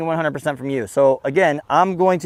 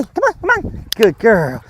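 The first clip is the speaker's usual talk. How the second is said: exciting dog call; 6 semitones higher, slower than usual. Shown as a demonstration. Trainer keeping the dog engaged, excited. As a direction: coax the dog excitedly, settle into praise. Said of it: "trying to be exciting and cooler".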